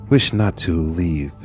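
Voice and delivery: ordinary delivery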